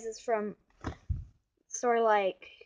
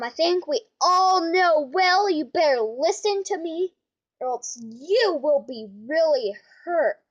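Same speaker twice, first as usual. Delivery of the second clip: ordinary talk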